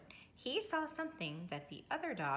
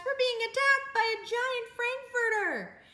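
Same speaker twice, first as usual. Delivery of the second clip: high, louder squealing cat voice; panicked cats; 14 semitones higher, 6 dB louder than usual. A narrator's reading. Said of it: "cried the cats".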